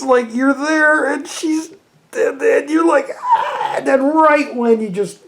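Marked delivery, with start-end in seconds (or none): 3.2-3.8 short high pleasure gasp, hammed up; mock climax moan. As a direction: play the build-up moan for laughs. Acting out climax build-up.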